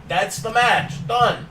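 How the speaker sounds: normal talking voice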